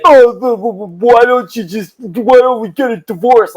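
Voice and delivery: high, dopey stammering moron; mock him as the biggest moron on the planet; moron dad voice. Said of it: "she does this voice where she talks about my dad and she makes him sound like the biggest moron".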